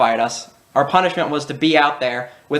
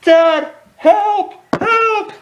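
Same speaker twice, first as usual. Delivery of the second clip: very high, louder playful call for help; mock cry for help; 5 dB louder, much slower than usual. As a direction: squeal it like a kidnapped kid. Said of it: "Curtis was like".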